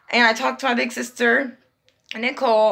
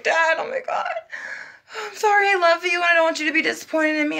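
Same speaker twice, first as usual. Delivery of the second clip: tearful plea; 7 semitones higher than usual. Retold or imitated. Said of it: "I was like".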